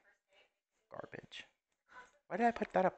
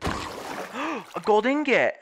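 excited; 10 semitones higher, 5 dB louder, much slower than usual. Real, not acted. Finds loot in a game.

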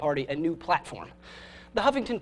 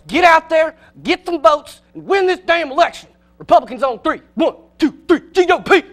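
higher, louder Southern coach hollering the team up; rallying shout; 9 semitones higher, 9 dB louder than usual. Acted. Southern coach rallying Republicans on three, loud Southern shout. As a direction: whip the huddle into a frenzy in a Southern accent.

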